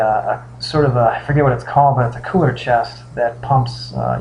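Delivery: ordinary delivery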